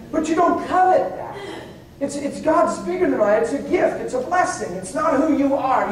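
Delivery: ordinary talk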